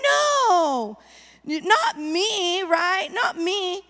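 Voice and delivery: mock whiny denial. Preacher mocking someone who won't admit fault, fake-crying. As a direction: whimper it like a crybaby in denial. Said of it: "And you're like".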